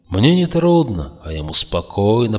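usual speaking voice

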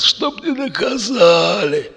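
high, slow strained rasp; wheezing. A narrator's reading. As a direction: croak out a last plea. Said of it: "прохрипел Макс". (высокий натужный хрип)

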